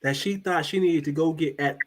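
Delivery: ordinary talk, nothing marked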